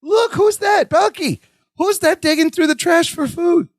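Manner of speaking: high-pitched, put-on wondering act; manipulative Jennifer. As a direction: act out a manipulator pointing into the distance. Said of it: "she pulls the Larry bit".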